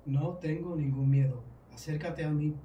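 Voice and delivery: normal talking voice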